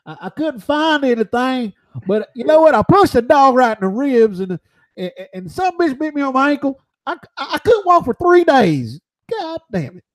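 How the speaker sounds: high, loud, rough Southern boxer voice; Butterbean impression. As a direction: play the boxer Butterbean, boasting. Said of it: "you can do the accent".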